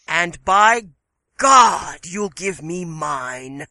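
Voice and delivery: loud, furious demand; angry. Acted. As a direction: roar the threat at her. Furious man in story.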